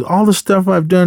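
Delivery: high, aggrieved imitated rant; mock angry yell. Fed-up teacher lists his sacrifices, mock yelling. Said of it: "he was just yelling at all of them".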